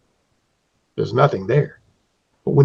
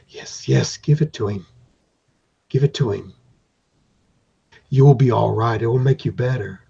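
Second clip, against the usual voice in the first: lower, softer coaxing voice; inner echo voice; 3 semitones lower, 5 dB quieter than usual. Acted. Inner voice urging the hero to give it up: lower, softer coaxing. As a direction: tempt the player from inside their head. Echoing voice in player's head.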